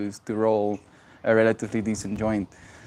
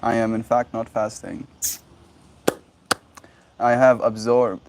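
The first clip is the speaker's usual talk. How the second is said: ordinary delivery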